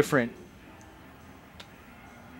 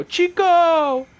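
very high, loud fan holler; imitated crowd shout; 7 dB louder than usual. Imitating someone in the crowd greeting Scott Hall, high shout. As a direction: holler it like a heckler from the stands. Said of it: "you hear someone in the crowd go".

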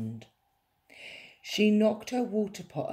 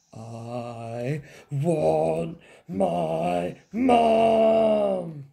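put-on loud, long child's yell; shrieking wail; 6 dB louder, much slower than usual. A narrator's reading. Princess interrupted by the little prince, shrieking. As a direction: stretch every word and yell Mum. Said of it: "shrieked the little princess".

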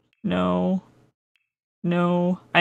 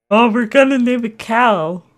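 normal talking voice

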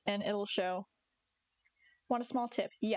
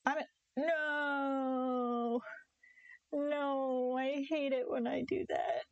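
long drawn-out, higher-pitched no; dismayed wail; 4 semitones higher, much slower than usual. Real, not acted. Player after a slip in the game, drawn-out dismay. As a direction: moan it out in frustration at a mistake.

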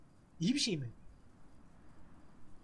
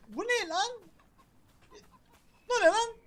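much higher, louder startled cry; alarmed surprise; shocked yell; 12 semitones higher, 6 dB louder than usual. Real, not acted. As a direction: shout at the strange thing. Shocked by something on screen.

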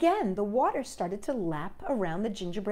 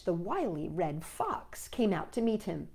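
ordinary delivery

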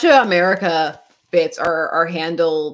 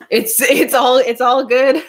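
laughing; 6 semitones higher, 4 dB louder than usual. Real, not acted. Laughing over sticky paper question.

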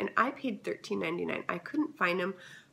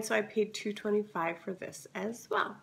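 usual speaking voice

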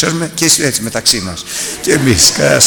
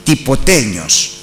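slow, loud, higher preaching voice; fiery preaching; 5 semitones higher, 5 dB louder, slower than usual. Acted. Preacher warning the congregation, loud and slow. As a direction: drive it home slowly from the pulpit.

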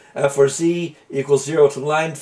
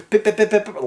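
higher-pitched, rapid string of pips; imitated long beeps; 6 semitones higher, faster than usual. Shown as a demonstration. Programmer showing the unshortened sound, imitated machine pips. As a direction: mimic a machine's repeated pips. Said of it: "instead of having it make long strings".

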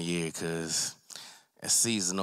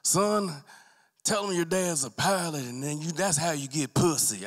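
higher, lightly voiced boastful father; colonel's advice; 8 semitones higher than usual. Colonel Garland advising his son, lightly acted. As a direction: hand down the advice like a proud pilot dad. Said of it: "He said".

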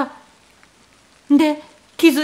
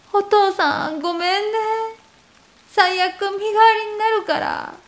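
tearful; 7 semitones higher than usual. Retold or imitated. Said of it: "しかも泣きながら".

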